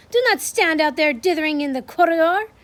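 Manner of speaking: impatient snapping. Acted. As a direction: hurry them in with a scold. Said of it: "snapped the Grand High Witch".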